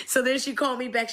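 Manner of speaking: usual speaking voice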